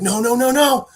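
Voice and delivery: rapid rising string of refusals; mock alarm. [name] hearing Melvin is down there, mock alarm. [name] reacting to bad news.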